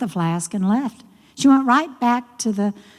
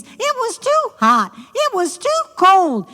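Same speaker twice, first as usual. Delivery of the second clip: whiny; 11 semitones higher than usual. A narrator's reading. Narrator mimics frog whine.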